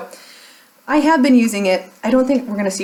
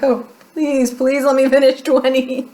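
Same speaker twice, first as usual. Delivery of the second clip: mock pleading; 4 semitones higher, 4 dB louder than usual. Acted plea to herself.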